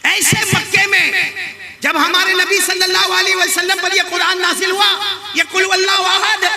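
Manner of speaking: high, shouted proclamation; impassioned preaching. Real, not acted. Preacher proclaiming the Quran's open challenge, impassioned. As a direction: swear it to the crowd at full force.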